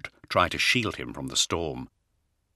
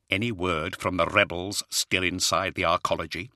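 usual speaking voice

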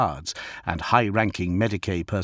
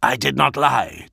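ordinary delivery, nothing marked

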